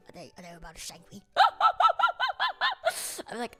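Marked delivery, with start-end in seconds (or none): 0.1-1.2 rushed paranoid whisper; fast, scared whisper. Acted. 1.3-3.3 high, loud burst of laughter; loud high cackle. Real, not acted.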